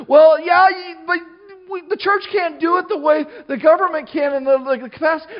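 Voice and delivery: high, loud protesting start; mock objection. Preacher voicing people who doubt the church can cope, mock protest. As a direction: pipe up as a doubter making excuses.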